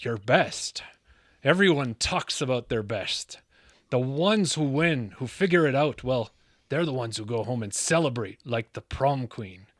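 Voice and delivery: bad movie-star impersonation, slushy s sounds; poor Sean Connery. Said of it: "that was a very poor Sean Connery".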